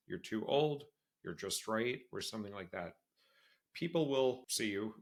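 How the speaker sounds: ordinary talk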